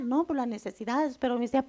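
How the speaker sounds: usual speaking voice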